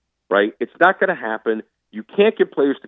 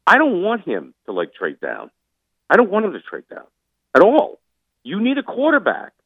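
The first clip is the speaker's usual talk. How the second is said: pitched way up, insistent and emphatic; fired-up plea; 11 semitones higher than usual. Real, not acted. Pushing the GM hard to trade up, fired-up high plea. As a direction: hammer home that they need a quarterback. Passionate demand for a quarterback.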